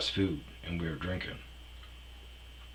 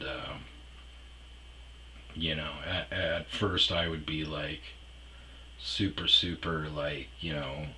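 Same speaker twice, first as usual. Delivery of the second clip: normal talking voice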